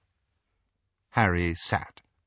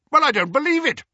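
much higher, louder blustering insistence; blustering uncle; 6 dB louder, faster than usual. A narrator's reading. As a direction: insist stubbornly. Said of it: "repeated Uncle Vernon".

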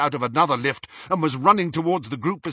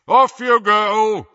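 slow, loud, raised command; booming; 10 semitones higher, 11 dB louder, slower than usual. A narrator's reading. Harry sends the Muggleborns off, booming. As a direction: boom it out across the hall. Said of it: "he boomed to the Muggleborns".

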